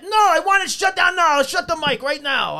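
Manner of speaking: high, insistent mock demand; irate high-pitched imitation; mad club owner. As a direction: act the owner demanding an instant shutdown. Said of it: "he's fucking mad".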